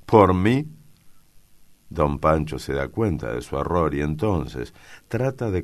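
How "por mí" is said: high, loud dismissive question; scornful exclamation. A narrator's reading. Son shrugging at father's order, scornful. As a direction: toss it out with contempt. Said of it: "exclama en tono despectivo".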